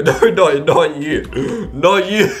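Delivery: helpless laughing protest. Real, not acted. YouTuber telling streamer he didn't mean him, laughing. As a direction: get the words out through giggles. Laughing, correcting who was meant.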